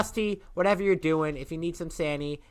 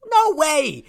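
high, loud no way; loud exclaim; 12 semitones higher, 9 dB louder than usual. Acted. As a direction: shout it out in disbelief. Denies he watched baseball.

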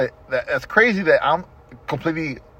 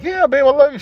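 much higher, eager retold reassurance to his wife; pleading reassurance; 12 semitones higher, 4 dB louder than usual. Husband on phone reassuring doubtful wife, high eager pleading. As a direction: overeager to convince, pitched way up. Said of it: "And I'm like".

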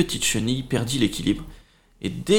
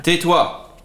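curt order; 5 semitones higher, 4 dB louder than usual. Acted. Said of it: "l'énorme punaise lui coupa la parole".

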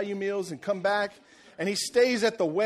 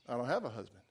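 quiet; 8 semitones lower, 9 dB quieter, faster than usual. Retold or imitated. Woman answers Jesus.

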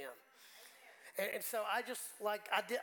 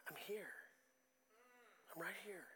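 much softer, intimate reassurance; soft voice of God; 11 dB quieter than usual. Retold or imitated. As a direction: say it gently, barely above a breath. God's voice felt in worship.

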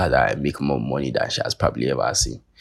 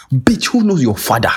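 higher and louder scornful jab; imagined comeback; 8 semitones higher, 5 dB louder than usual. Retold or imitated. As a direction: fire it back with contempt. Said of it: "for not saying".

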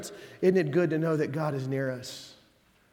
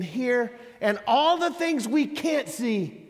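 higher, louder fervent preaching; full-force preaching; 8 semitones higher, 4 dB louder than usual. Acted. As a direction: build the sermon to its peak. Preaching climax.

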